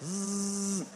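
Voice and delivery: imitated phone buzz; steady, drawn-out buzzing; phone vibration.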